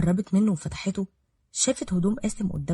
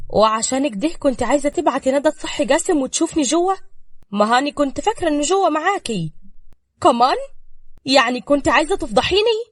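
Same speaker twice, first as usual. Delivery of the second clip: louder, higher angry accusation; heated quarrel; 7 semitones higher, 6 dB louder than usual. A narrator's reading. Ruqaya and Safaa quarrel over the planned scandal. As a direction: throw the scheme back at her.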